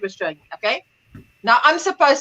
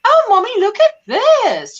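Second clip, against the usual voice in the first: put-on high, louder, drawn-out child calling Mommy; eager daughter; 9 semitones higher, 4 dB louder, slower than usual. Daughter showing mother her clay find, eager. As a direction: imitate a daughter keen to show off. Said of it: "So she's going".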